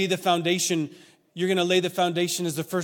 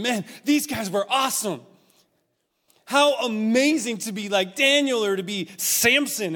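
higher, emphatic amazement; youthful awe; 5 semitones higher, 4 dB louder than usual. Retold or imitated. Kid in church marvelling at Bible heroes, awestruck. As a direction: marvel out loud at your heroes.

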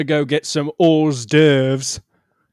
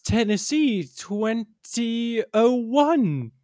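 proud lady; 7 semitones higher, much slower than usual. Acted. Lady proudly states title.

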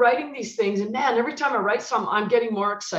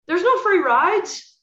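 slow, louder and higher, every word weighted; firm; 6 semitones higher, 5 dB louder, slower than usual. Retold or imitated. Coach warning the player about the price, firm and loud. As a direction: lay down the law.

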